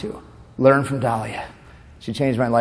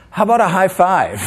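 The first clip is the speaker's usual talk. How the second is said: higher imitated offer; sweet sister; 6 semitones higher, 3 dB louder than usual. Imitating sister answering a marriage proposal, lightly voiced offer. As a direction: pitch it up, lightly voicing a sister. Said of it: "and she goes".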